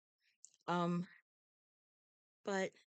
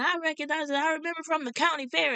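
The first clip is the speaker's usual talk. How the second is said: eager fan; 8 semitones higher, faster than usual. Retold or imitated. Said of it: "this guy starts saying".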